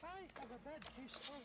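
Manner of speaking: high, put-on teacher voice; language teacher voice. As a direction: mimic the old English lesson video. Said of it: "and it was the".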